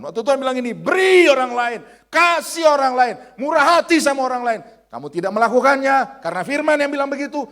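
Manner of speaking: high, loud shouted sermon; full-force preaching. Real, not acted. Preacher rebuking those who disobey God's word, shouted preaching. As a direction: drive each command home at full volume.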